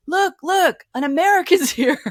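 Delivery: high, loud mock waiter calling out; imitating a French waiter, high and loud; teasing French waiter. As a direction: announce the American with glee. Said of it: "they're like".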